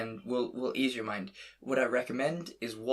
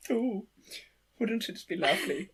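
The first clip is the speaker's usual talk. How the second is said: put-on high, dainty German-accented voice; dreamy, high-pitched German phone lady; German lady impression; 10 semitones higher than usual. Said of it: "lovely blonde German lady on the other end".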